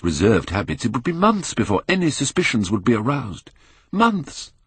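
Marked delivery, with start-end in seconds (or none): 3.9-4.5 excited exclamation. A narrator's reading. Inner exclamation.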